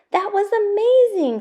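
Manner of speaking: thrilled cheer from Poppy; excited praise. Acted. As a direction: gush like a proud grandfather. Poppy thrilled after the video.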